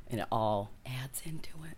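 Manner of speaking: trailing off in a whisper. Real, not acted. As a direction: breathe the words softly. Drops to a whisper.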